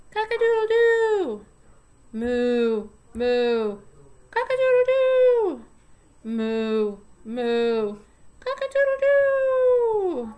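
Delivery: cheerful farm animal calls; animal sounds for a toddler. Shown as a demonstration. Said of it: "let's do the pattern with the animal sounds".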